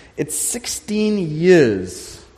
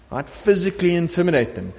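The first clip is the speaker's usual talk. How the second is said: usual speaking voice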